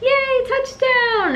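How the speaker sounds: high, slow, drawn-out cheer. Retold or imitated. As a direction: cheer like your team just scored. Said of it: "We were like".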